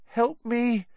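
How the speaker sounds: high frightened moan. A narrator's reading. Neville begging Hermione for help, high frightened moan. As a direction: whimper a plea for help. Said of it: "he moaned to Hermione".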